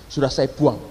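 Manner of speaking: low, understated matter-of-fact answer; imitated offhand reply. Foreign passenger answers about the boarding pass, imitated offhand reply. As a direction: deliver the news low, as a finished fact.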